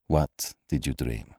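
low, slow, soft doctor; pitying. A narrator's reading. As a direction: ask gently, voice dropped and unhurried. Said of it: "There was pity in his voice".

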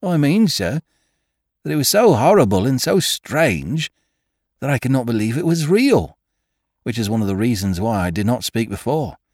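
high, deferential servant voice; a nervous old gardener pleading; humble gardener voice. A narrator's reading.

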